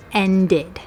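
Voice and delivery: slow "ended" as end-id; extra syllable. Shown as a demonstration. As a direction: stretch "ended" into two clear syllables. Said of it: "Add an extra syllable".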